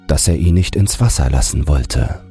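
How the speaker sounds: ordinary delivery, nothing marked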